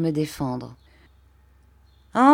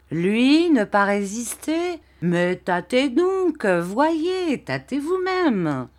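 high, slow pushy peasant; indignant insistence; 6 semitones higher, slower than usual. A narrator's reading. Barberin urging the old man to feel the boy, high and slow. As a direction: make him feel the arms, protesting.

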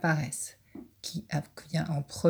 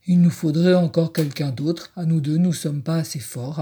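louder wolf voice, conceding; admitting wolf; 6 dB louder than usual. Acted. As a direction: own up, big wolf voice. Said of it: "avoue le loup".

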